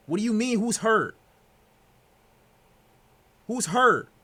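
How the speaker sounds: high, loud, urgent questions; alarmed shout. Real, not acted. Streamer yelling at chat about an injury, alarmed. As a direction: demand an answer, alarmed. Learning a player is hurt.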